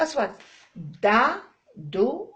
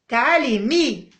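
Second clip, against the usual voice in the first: calling out; 3 semitones higher, 8 dB louder, slower than usual. Pretend. Teacher calls letter like pupil.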